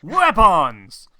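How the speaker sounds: loud, falling shout; exasperated shout. Real, not acted. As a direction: bark the word out in despair. GM exasperated at players.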